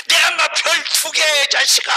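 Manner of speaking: loud, high mock telling-off; scolding father. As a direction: pull rank on the son, hammed-up. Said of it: "하면서 혼내".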